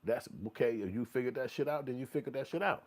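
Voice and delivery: ordinary talk, nothing marked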